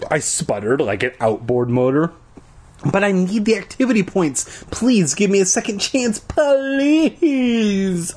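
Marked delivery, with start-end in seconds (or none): 2.8-8.1 rising to a very high whiny please; boy begging for a second chance, whiny. A narrator's reading. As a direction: beg harder with each word. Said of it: "I begged".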